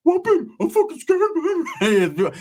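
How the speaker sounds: way up high, squeaky and scared; mock him in a tiny high voice; pinched high mock of a scared homeboy; high squeaky Rick voice. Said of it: "Now he's like".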